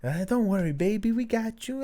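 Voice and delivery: high sing-song cooing; mock soothing. Dad retelling how he cooed to his crying baby, high and sweet.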